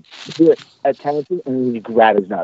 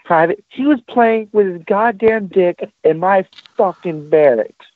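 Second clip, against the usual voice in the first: very high, slow exasperated rant; exasperated sergeant major; 10 semitones higher than usual. Retold or imitated. Sergeant major snapping at the stammering private, very high exasperation. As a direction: stretch it out high in disbelief. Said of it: "and he goes".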